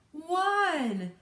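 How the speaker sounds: high, loud, drawn-out final count; shouted count. A narrator's reading. Countdown ends before the blast, high loud shout. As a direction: shout the last number of a countdown.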